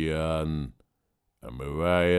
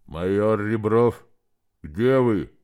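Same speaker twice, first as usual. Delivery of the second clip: louder muffled voice through a mask; firefighter air mask; 5 dB louder, slower than usual. Acted. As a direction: call through a face mask. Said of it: "сдавленный голос, словно его обладатель говорил сквозь маску".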